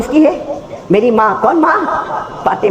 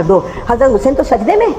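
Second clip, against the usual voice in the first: ordinary talk, nothing marked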